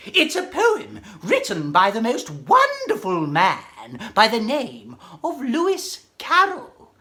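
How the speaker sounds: ordinary delivery, nothing marked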